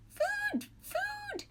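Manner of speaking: very high chick peep; gosling peep. A narrator's reading. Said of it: "peeped the gosling".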